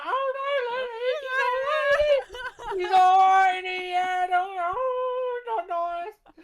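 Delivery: very high, loud fake bawling; wildly hammed-up sobbing; mock crying. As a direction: wail it in a squealed, drawn-out blubber.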